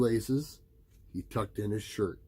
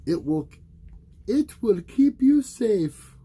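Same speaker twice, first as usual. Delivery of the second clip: high, slow, motherly reassurance; mother's voice; 12 semitones higher, 9 dB louder than usual. A narrator's reading. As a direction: gently promise he'll be safe. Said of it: "his mother told him".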